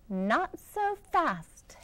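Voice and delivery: hissed. Acted. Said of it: "hissed an unpleasant voice".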